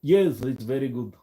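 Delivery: hammy mock Russian accent; bad Russian accent. Streamer who can't do Russian tries it anyway, bad Russian accent. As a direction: have another go at a poor Russian accent. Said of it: "I can't do Russian".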